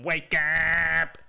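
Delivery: high, loud, drawn-out "wake up" blare; imitated alarm yell. Alarm interrupting a pleasant dream, long loud mimicked yell. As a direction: stretch it out like a blaring alarm. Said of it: "it sounds like ... ten times louder".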